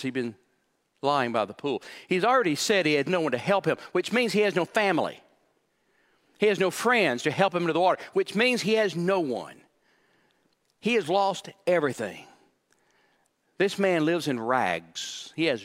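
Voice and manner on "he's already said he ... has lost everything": usual speaking voice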